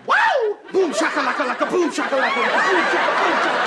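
very high, loud whoop into a gleeful chant; wild celebration. Acted. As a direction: whoop and chant like you just scored a date. Celebrating after getting a date.

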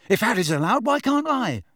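put-on high, indignant boyish protest; shouted protest. A narrator's reading. Son arguing with his mother, high shouted protest. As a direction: protest loudly, climbing high on the question. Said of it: "shouted Ron".

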